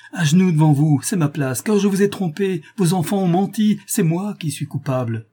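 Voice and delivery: high, impassioned confession; energetic outcry. A narrator's reading. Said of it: "s'écria-t-elle avec énergie".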